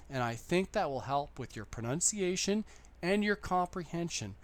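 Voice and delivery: ordinary delivery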